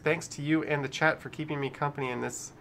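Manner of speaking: ordinary delivery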